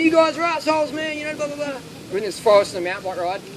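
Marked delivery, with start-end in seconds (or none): 0.0-1.8 higher, mock-indignant rant; mock outraged friend. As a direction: imitate the friend complaining, pitched up. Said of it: "He's going".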